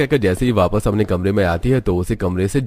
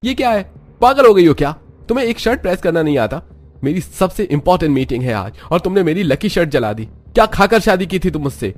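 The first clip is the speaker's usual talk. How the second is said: high, loud furious yelling; angry shouting; 9 semitones higher, 4 dB louder than usual. Acted. Husband to wife over the burnt shirt, shouting. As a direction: tear into her over the shirt. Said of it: "गुस्सा होते हुए कहता है".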